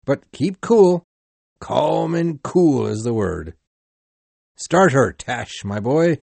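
loud but steadying call to the crew; mate calling loudly to his harpooneer; loud urging. Acted. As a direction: call out keep cool over the noise.

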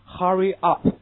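British "Hurry up" with an open uh in Hurry; British English. Shown as a demonstration. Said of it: "这是英国人的读法". (英式发音读Hurry up)

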